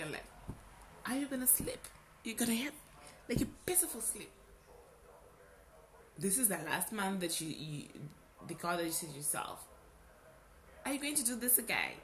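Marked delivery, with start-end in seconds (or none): 1.1-11.9 needling inner voice. Retold or imitated. Her conscience mocking her before bed, needling tone. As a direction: taunt yourself like a sly conscience. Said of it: "that voice, you know, came back".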